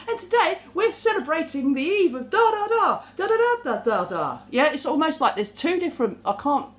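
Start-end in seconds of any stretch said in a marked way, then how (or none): 0.1-4.4 high mock announcement falling into da-das; sing-song newsreader parody, starting high, then dropping. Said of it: "drop your voice and go".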